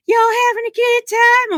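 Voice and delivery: put-on a chirpy Southern accent greeting the raid; country Southern accent. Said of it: "in her country southern accent".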